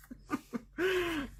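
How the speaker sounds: a light high giggle; quick amused giggle; short high laugh. Real, not acted. Laughing at busy retreat.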